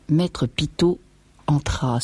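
ordinary delivery, nothing marked

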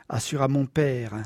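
ordinary delivery

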